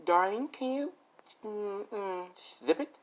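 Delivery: sweet on top, a threat underneath; sugary threat. Acted. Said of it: "She's like".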